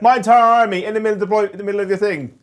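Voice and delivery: fast, loud mock panic, laughing; panicked. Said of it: "I didn't want you to be like".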